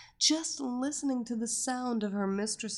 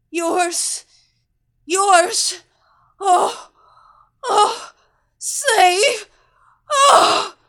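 much higher and louder, crying out; nearly shrieking in rapture; ecstatic cries; 11 semitones higher, 16 dB louder, much slower than usual. A narrator's reading. Captive repeating over and over, high ecstatic cries. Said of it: "she repeated over and over".

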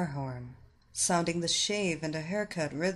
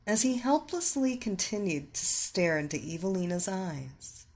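plain reading voice

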